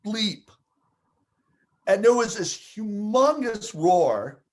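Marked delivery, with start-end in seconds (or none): 0.1-0.4 high, slow, amazed gasp of disbelief; stunned crowd. Retold or imitated. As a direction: say it like a whole crowd in shock. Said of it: "went from laughing to going".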